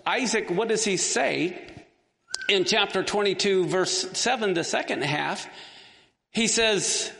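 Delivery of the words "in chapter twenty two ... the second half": usual speaking voice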